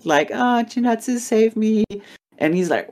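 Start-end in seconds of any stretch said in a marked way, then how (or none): 0.3-1.8 high-pitched, play-acted cry for help; mock damsel plea. Girl runs to Chinatsu for rescue, mock pleading.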